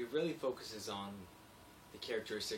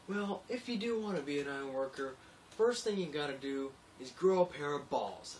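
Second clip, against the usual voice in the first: higher, louder tough-guy voice; tough iron worker; 5 semitones higher, 5 dB louder than usual. Retold or imitated. Iron worker giving the narrator crude advice, macho voice. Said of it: "he goes".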